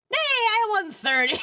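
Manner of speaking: high, drawn-out laughing cheer; excited laughing. Real, not acted. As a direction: crow over a win through real laughter. Laughing at a win on stream.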